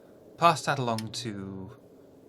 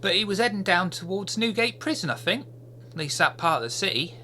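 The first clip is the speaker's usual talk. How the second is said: high, eager urchin voice reporting back; childish voice, higher and louder; street kid Peter; 8 semitones higher, 4 dB louder than usual. Acted. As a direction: chatter like a keen little spy.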